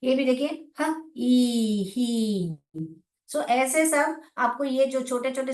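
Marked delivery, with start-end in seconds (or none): 0.8-2.9 "ह, ई" sounded apart, then blended into "ही"; sounding out he. Shown as a demonstration. Teacher shows blending sounds: "ह, ई" into "ही". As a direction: split "ह" and "ई", then join as "ही". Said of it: "जैसे कि ये she, he है".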